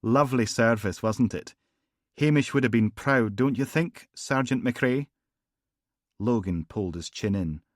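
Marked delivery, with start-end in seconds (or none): 0.0-5.1 higher, louder smooth charm; silky gangster. Acted. Gangster charming Logan after the funeral, silky. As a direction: purr the polite menace. McLennan's smooth small talk.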